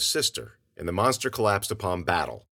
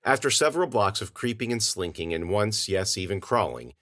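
plain reading voice

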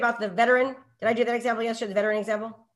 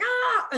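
startled teacher cry; 12 semitones higher than usual. Retold or imitated. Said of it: "'Cause I'm always like".

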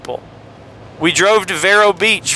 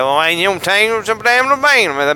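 mock Mississippi neighborhood accent; rural Mississippi accent; 3 semitones higher than usual. Host imitates Brother Williams' neighborhood, mock Mississippi accent. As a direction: garble it in a thick Mississippi accent. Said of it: "they ain't talking like that".

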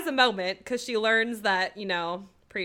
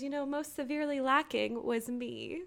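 softly voiced, mildly sad quote; retold sadness; 2 semitones higher, 8 dB quieter than usual. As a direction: retell it quietly with a touch of sadness. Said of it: "And then it's really sad".

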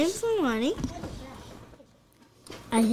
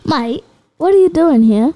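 loud, hammed-up British accent; British accent performed on demand, louder and higher; 4 semitones higher, 12 dB louder than usual. Shown as a demonstration. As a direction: belt it out in a put-on British accent. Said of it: "let me hear your British accent".

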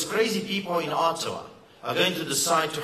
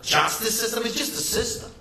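Justice system said higher, mocking; sarcastic; 5 semitones higher than usual. Shown as a demonstration. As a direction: sneer at the word justice. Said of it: "I can't even say it without being sarcastic".

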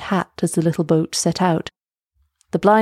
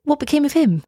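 put-on high, rising girl's question; higher-pitched girl character, questioning; Elsa asking; 8 semitones higher than usual. A narrator's reading. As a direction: ask anxiously after the last man. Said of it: "asked Elsa".

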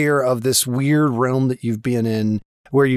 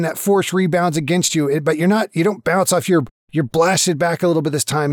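ordinary talk

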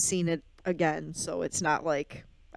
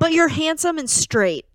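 higher, louder objection; playful protest; 9 semitones higher, 7 dB louder than usual. Real, not acted. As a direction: push back loudly and pointedly. Mock protest at castmate's self-deprecation.

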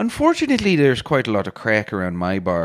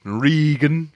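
put-on storytelling in a broad Irish accent, rolling along. Irish bar owner telling his funeral story in an Irish accent. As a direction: spin the yarn like an Irish pub teller.

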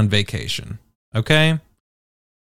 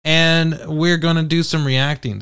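ordinary talk

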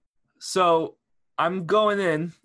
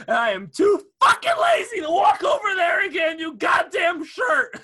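mock rant; 11 semitones higher, 7 dB louder than usual. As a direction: shout at him in playful fake fury.

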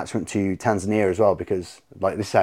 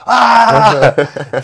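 high, loud mock scream; panicked yell; 13 semitones higher, 11 dB louder than usual. Teller as soldiers missing their rifles, mock panicked yell. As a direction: imitate the soldiers' alarmed shriek.

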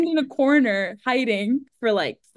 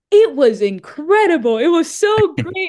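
high, loud, slow gushing lie; gushing fake praise; 7 semitones higher, 8 dB louder, slower than usual. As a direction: overdo the praise to his face, drawn out. Lying enthusiastically to director.

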